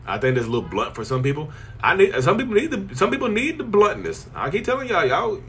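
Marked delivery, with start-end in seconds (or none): 1.8-5.4 emphatic. Real, not acted. Emphatic insistence on bluntness.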